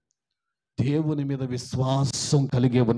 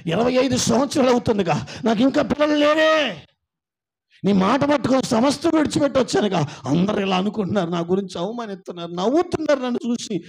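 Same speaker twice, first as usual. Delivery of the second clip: pleading complaint; 6 semitones higher, 7 dB louder than usual. Acted. Imagined complaint to God.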